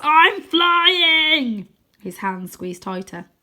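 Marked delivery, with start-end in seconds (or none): 0.0-1.7 high, loud triumphant shriek; Croaky shrieking as he takes flight. A narrator's reading. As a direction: screech with wild joy. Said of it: "he shrieks".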